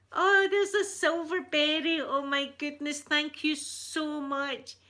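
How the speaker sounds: high, loud delighted burst; excited thanks. Real, not acted. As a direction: gush with surprise at the gift. Thanks viewer for silver membership.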